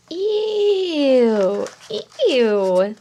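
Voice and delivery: high, loud, disgusted ew; hammed-up yuck for kids. Shown as a demonstration. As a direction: pull a face and squeal it in disgust. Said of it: "you could do something like".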